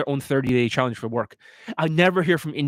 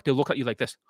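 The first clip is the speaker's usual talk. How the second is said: ordinary talk, nothing marked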